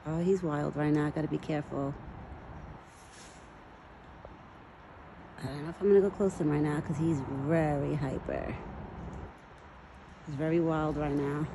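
low, soft commentary; wary aside. Real, not acted. Woman unsure about approaching her hyper buck, quiet aside. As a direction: talk low to yourself, keeping a careful distance.

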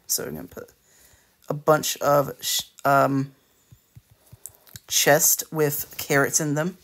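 ordinary delivery

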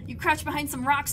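ordinary talk, nothing marked

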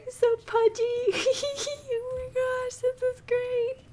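high-pitched cute-overload squealing; gushing squeal. Real, not acted. Player cooing over a pudgy little cow, gushing squeal. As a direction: gush way up high, giggly and delighted.

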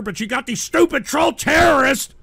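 loud, high-pitched rant; exasperated outburst. Real, not acted. Streamer blaming trolls for ruining his reality, exasperated outburst. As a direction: explode in frustration at the culprits.

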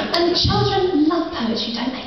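high, sing-song mock parent; gushing parent. Mock parent cooing to the poet about children. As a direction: gush it like an eager mum. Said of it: "And they're like".

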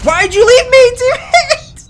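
high, loud, whining at teammate; high whiny yell. Real, not acted. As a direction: wail it out, hurt and betrayed. Abandoned by teammate, cries out.

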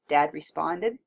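ordinary delivery

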